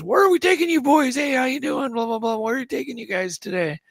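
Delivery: high, chirpy mock pilot greeting; imitating the pilot. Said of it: "and he goes".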